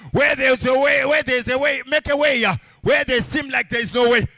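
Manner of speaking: loud, rising sermon cadence over the music; preaching at full force. Acted. Pastor rallying the congregation, loud preaching. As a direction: preach it to the whole church.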